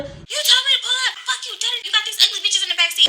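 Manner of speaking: high-pitched, laughing imitation of a yell; a high, laughing mock yell from the girlfriend. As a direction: squeal the angry girlfriend's lines. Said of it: "she's yelling".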